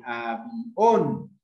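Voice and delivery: "avión" stretched slowly, its vowels pulled apart; drawn-out syllables. Shown as a demonstration. Teacher showing a split diphthong, "avión" slowly sounded out. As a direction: draw out "avión" so the i and o separate. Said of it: "la sílaba tónica está en I".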